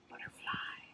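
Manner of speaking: soft, airy whisper. Shown as a demonstration. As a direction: whisper it like a butterfly. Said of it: "soft whisper of a".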